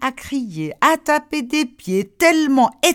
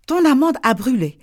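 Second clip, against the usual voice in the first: ordinary delivery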